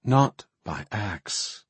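ordinary talk, nothing marked

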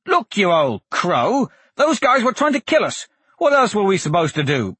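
high, heated protest from the prisoner; indignant accused. Acted. Blaze protests to the magistrate that they were attacked, indignant. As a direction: argue back with pitch soaring mid-way.

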